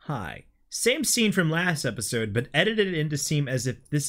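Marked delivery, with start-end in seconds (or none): 0.1-0.4 awkward. Acted. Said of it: "awkwardly says".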